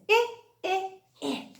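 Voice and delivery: "I" said as the short i of inchworm, not the letter name; short i sound. Shown as a demonstration. Teacher sounding out the letter I to the class, short i. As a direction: repeat the short i, three times. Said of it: "I says".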